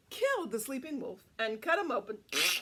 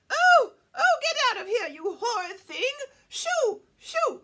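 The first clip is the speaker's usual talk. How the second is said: furious shouting, much louder and higher; Granny cries out; 11 semitones higher, 12 dB louder than usual. A narrator's reading. Granny chasing the wolf out of her house, loud furious shout. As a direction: shoo him off at full voice. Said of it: "she cried".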